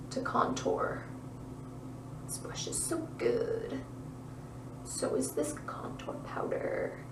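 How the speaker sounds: soft breathy whisper to the camera. Real, not acted. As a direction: whisper it like a little secret.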